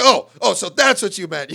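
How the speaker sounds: hammed-up affronted outburst; mock indignation. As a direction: imitate someone suddenly taking offence. Acting the offended driver's outburst.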